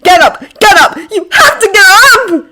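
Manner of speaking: loud, high, frantic pleading; panicked self-urging shouts; desperate begging. A narrator's reading. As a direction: beg yourself urgently, rising to a cry. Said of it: "begging himself".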